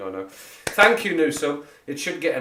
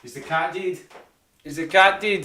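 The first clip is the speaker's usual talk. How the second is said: Scottish lilt, pitched up; Scottish phrase; 9 semitones higher, 6 dB louder than usual. Shown as a demonstration. Explaining Scottish slang to chat, Scottish lilt. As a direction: ask it the Scottish way. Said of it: "That's what they say in Scotland".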